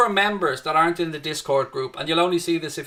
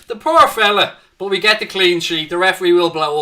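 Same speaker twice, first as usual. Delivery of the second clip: louder and higher, gleeful commentary; excited; 3 semitones higher, 7 dB louder than usual. Real, not acted. Host celebrating his team's clean sheet on stream, gleefully loud. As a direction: cheer the clean sheet to the viewers.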